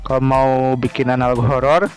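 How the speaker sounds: ordinary talk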